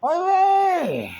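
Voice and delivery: high, loud cheering yell. Retold or imitated. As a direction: cheer the horse on from the rail. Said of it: "they're yelling".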